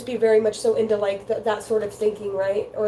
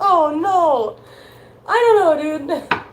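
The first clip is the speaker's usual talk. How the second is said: high, louder, laughing oh no; laughing embarrassment; 6 semitones higher, 7 dB louder than usual. Real, not acted.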